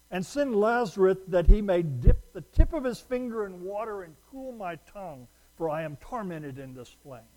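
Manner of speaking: a begging voice from the flames; rich man pleading. Acted. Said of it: "they go like this".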